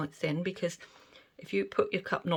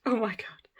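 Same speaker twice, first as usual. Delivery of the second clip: breathless whispered wonder; hushed, as if afraid to break it; awed whisper; 4 semitones higher than usual. Real, not acted. Awed at result.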